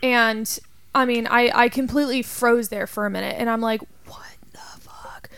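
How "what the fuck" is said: whisper. Retold or imitated. Whispered quote of fright.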